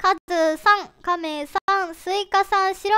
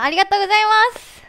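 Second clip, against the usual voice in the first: quick, loud burst of thanks; 4 semitones higher, 8 dB louder, faster than usual. Real, not acted. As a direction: shout thanks to the whole stage. (大きな声で勢いよく感謝)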